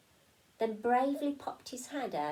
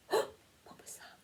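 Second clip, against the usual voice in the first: frightened whisper. Acted. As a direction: gasp then breathy scared whisper. Frightened in dark wreck.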